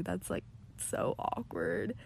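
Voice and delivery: breathy whisper, slow. Real, not acted. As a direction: whisper it, cringing. Reacting to an awkward story.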